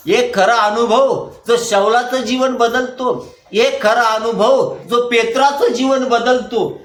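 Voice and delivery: slow, drawn-out, emphatic refrain; rhythmic preaching. Real, not acted. Preaches in repeated phrases.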